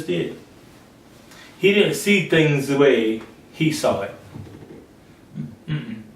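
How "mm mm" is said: ordinary talk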